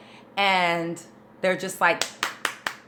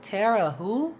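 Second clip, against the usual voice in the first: acting out working out a name, pitched higher; mock confusion turning to realisation; puzzled sounding-out; 5 semitones higher, 3 dB quieter, slower than usual. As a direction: puzzle over the name, then get it. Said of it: "because I'm like".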